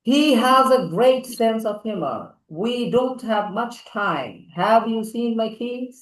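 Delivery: slow teacher demo reading; smart sentences. Shown as a demonstration. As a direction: deliver each line like a polished example. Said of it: "একটু smart করে বলো".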